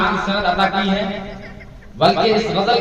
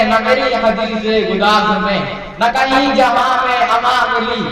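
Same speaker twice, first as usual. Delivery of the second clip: ghazal recitation; 4 semitones higher than usual. Acted. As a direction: chant each verse with lilting tarannum. Recites Iqbal ghazal at mushaira.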